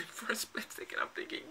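hushed confessional whisper; whispered aside. Acted. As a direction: whisper it like a guilty secret.

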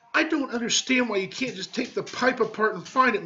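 high, whiny woman's complaint; complaining wife. Acted. Missus Pompaday complains to her husband, high and whiny. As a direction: nag him in a high whine.

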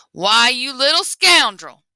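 loud, slow outraged roar; angry accusation. Acted. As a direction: tower over him and bellow. Buki catches Lapin.

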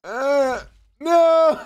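high, rising panicked no; panicked cry. Real, not acted. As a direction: cry out as you go down. Dying in the game.

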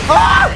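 very high, loud shriek; terrified scream. Real, not acted. Viewer watching the plane burn, terrified scream. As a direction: scream at the top of your range.

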